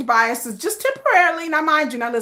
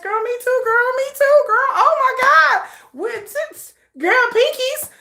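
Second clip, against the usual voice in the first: put-on high, squealing girlfriend chatter; excited gossip; 10 semitones higher than usual. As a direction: gush like a fellow fan widow. Acted gossip between war widows.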